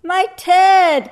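high, loud storybook gasp of shock. A narrator's reading. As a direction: gasp it out as the astonished bear. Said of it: "gasped the bear".

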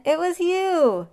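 high, slow, loud revelation; amazed cry. A narrator's reading. Everyone discovers who scared the lion, amazed cry. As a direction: stretch it out in delighted amazement. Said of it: "cried everyone".

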